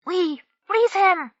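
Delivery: very high, slow excited cry; cried out. A narrator's reading. Smallspot flinging himself forward to save his friend, high cry. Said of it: "Smallspot cried".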